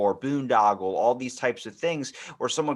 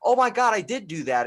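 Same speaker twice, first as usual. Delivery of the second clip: sudden realization; 7 semitones higher, 4 dB louder than usual. Retold or imitated. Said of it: "And it's like".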